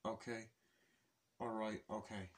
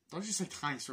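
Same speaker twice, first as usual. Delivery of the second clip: ordinary delivery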